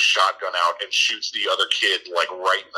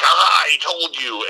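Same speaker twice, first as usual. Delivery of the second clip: mock gotcha shout; 8 semitones higher, 6 dB louder than usual. Lady jumps out behind him.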